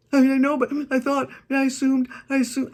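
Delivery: high, loud, fast flustered excuses; flustered assistant. Retold or imitated. Assistant excusing herself to her boss, high and flustered. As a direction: blurt it out like a caught, embarrassed assistant.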